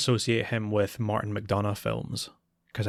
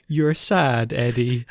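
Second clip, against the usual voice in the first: lightly imitating a depressing man, slow and loud; slow, dour consoler; 3 semitones higher, 8 dB louder, slower than usual. Guest character crouches to talk to Eddie, slow mock-dour consoling. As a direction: draw it out with mock-solemn comfort. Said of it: "he talks very slowly and very calmly".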